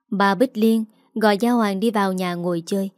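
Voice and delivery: ordinary delivery, nothing marked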